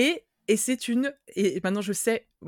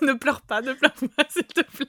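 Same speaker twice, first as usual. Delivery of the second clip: laughing; 4 semitones higher than usual. Real, not acted. Teasing friend, laughing through words.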